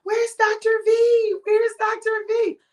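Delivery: put-on child voice calling for Doctor V; imitating granddaughter. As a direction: squeak it like a small child looking for someone. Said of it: "she be asking her grandmama".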